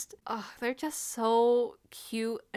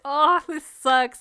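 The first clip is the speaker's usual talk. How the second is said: louder, slow, exasperated "this sucks"; frustrated groan; 6 semitones higher, 9 dB louder, slower than usual. Retold or imitated. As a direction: drag it out, fed up. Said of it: "I was getting so frustrated".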